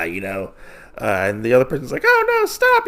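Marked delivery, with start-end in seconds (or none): 2.0-2.8 very high, loud mock wail; mock crying plea. Caged captive begging the wolf, high mock crying plea. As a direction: imitate a crying captive pleading in falsetto. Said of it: "they're crying and whatnot".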